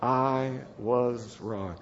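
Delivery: soft, slow and reluctant; half-hearted mumbled admission, drawn out; unenthusiastic. Shown as a demonstration. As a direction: say it with no enthusiasm at all. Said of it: "You say it with such enthusiasm".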